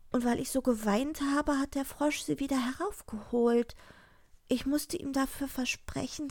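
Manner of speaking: put-on high, girlish confession; anxious princess. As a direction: explain pleadingly in a high voice. Princess explains to father.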